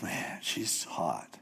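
slow, soft whispered awe; smitten whisper. Retold or imitated. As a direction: breathe it out, lovestruck. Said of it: "he's like".

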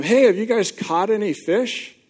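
mock shout from the shore to the boat; calling out. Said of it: "he yells out to them".

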